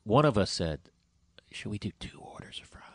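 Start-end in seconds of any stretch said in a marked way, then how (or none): 1.5-2.8 hushed, voiceless whisper; whispered quote. Retold or imitated. Proposing a double order to a friend, whispered. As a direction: whisper it like a guilty secret. Retelling a conspiratorial food suggestion.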